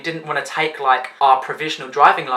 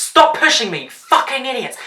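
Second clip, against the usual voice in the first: snapping; 9 semitones higher than usual. Retold or imitated. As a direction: bark it at the crowd. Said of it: "Charlotte just finally snapped".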